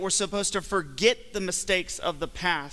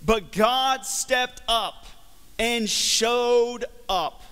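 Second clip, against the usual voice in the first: very slow, higher and louder proclamation; preaching climax; 5 semitones higher, 4 dB louder, much slower than usual. Real, not acted. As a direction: preach each word out, building triumph. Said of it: "Here it is".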